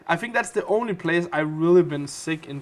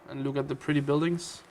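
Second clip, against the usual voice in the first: ordinary talk, nothing marked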